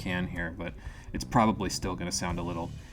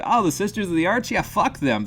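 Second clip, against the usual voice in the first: high, loud sneering mimicry; mocking the scribes; 9 semitones higher, 5 dB louder than usual. Mocking the scribes' careless verdict, high and sneering. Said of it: "and you decide".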